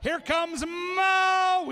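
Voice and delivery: announcer hyping the crowd for each corgi; drawn-out ring announcer call. Acted. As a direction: belt the name out long. Announcer introduces a racer.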